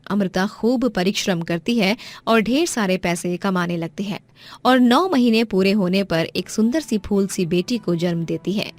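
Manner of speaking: usual speaking voice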